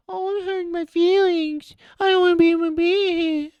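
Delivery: high, whiny mock of a sensitive person; squeaky mock-crybaby whine. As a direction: pitch way up and whine like someone offended. Mocking oversensitive people whining.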